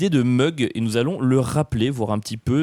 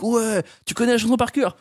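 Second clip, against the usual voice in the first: show host voice; 10 semitones higher than usual. Retold or imitated.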